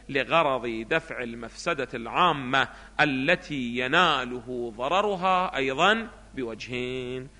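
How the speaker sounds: slow reading with long drawn-out vowels; textbook recitation. Acted. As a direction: recite the text slowly, almost chanting. Reads the textbook aloud. (قراءة بطيئة ممدودة للمتن)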